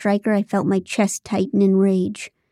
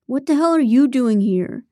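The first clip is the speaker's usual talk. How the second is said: higher-pitched indignant challenge; angry; 4 semitones higher than usual. A narrator's reading. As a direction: confront an unwelcome visitor, mildly angry. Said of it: "Ethan's tone was angry".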